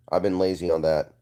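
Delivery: usual speaking voice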